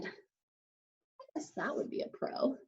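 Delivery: whispered aside, breathy and hushed. Real, not acted. Teacher whispers an aside to the class. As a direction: drop to a whisper for a quick aside.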